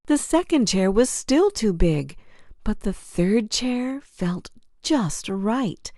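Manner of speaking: plain reading voice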